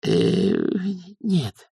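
faltering, hesitant little denial; hesitate, then get it out meekly; timid babble. A narrator's reading. Young witch answers her elders, babbling timidly. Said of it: "пролепетала Маград".

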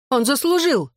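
high-pitched mild shout; cried protest. A narrator's reading. Woman defends slapping the narrator, a mildly shouted protest. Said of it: "крикнула Белла".

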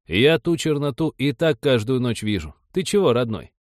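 put-on animated elderly rustic voice; old villager. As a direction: argue like an aged peasant staying put. Voices elderly villager being interviewed.

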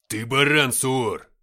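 loud angry outburst; cursing. A narrator's reading. Ignat to the captain over radio, cursing. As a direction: swear at him over the radio. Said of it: "выругался Игнат".

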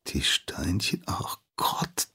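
unvoiced, horrified whisper; dismayed whisper. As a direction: breathe it out as the mistake dawns. Apprentice realizes he forgot.

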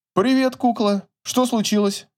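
casual friendly phone greeting; Игорь по телефону. A narrator's reading. (лёгкий голос Игоря в трубке)

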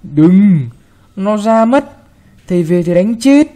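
anxious grandma calling out don't; grandma warning. Acted. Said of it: "Bà ngăn".

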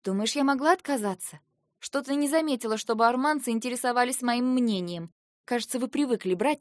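high, heated accusation; getting angry. A narrator's reading. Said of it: "Кажется, я начала злиться".

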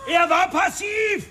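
high, shouting in desperate self-defence; a father's pained shout, cornered; anguished outburst. Acted. Father defends himself, breaking out.

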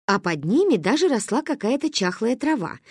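ordinary delivery

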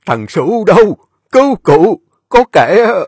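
put-on high, loud call from a wounded old man; dying old man calling his servant; weak cry for help. A narrator's reading. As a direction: call out with the last strength. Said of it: "cụ mới yếu ớt kêu lên".

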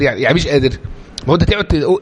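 normal talking voice

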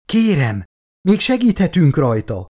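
anxious narrated entreaty; pleading. A narrator's reading. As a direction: plead for someone's life. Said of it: "futott könyörögve Elizabeth".

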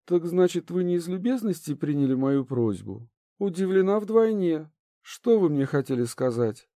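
a polite princess, lightly voiced. A narrator's reading. Princess character voice.

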